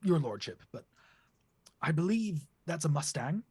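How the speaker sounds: high, prim duck butler; lightly high duck servant identifying cars. Acted. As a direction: clear the throat and report primly, up high. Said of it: "Sir William looks and goes".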